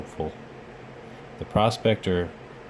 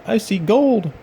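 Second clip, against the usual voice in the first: excited; 12 semitones higher, 6 dB louder than usual. A narrator's reading. Prospector finds gold.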